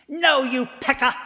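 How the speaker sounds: high angry scream. Acted. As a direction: scream it at him in a thick South Boston accent. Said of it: "she screamed".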